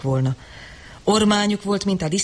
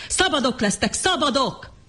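higher, urgent cry of freedom; shouting; 7 semitones higher than usual. A narrator's reading. John shouting to the attacking crowd. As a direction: cry szabadok out over the mob. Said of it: "ordította John".

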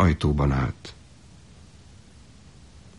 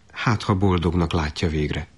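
usual speaking voice

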